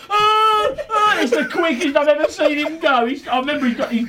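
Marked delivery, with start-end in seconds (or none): 0.1-1.2 high loud scared "ah, ah"; hammed-up panicked yelps; mock panicked cries. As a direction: imitate a panicking run. Imitating friend running scared.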